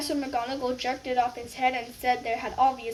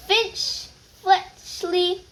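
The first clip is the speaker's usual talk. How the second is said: higher, very slow name call; 7 semitones higher, 4 dB louder, much slower than usual. Acted. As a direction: draw out the name across the hall. Names called out.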